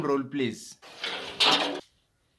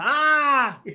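short high mock scream; playful imitated yelp; 9 semitones higher, 4 dB louder than usual. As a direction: act out the boyfriend's scream. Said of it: "You are screaming now".

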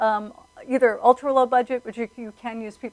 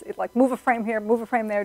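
ordinary delivery

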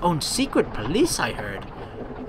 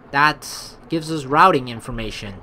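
ordinary delivery

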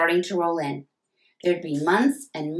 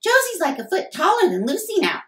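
put-on much higher, eager kid voice; high little-girl voice chiming in; little sister piping in; 5 dB louder than usual. A narrator's reading. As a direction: pipe up like a know-it-all little sister. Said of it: "piped in Amelia".

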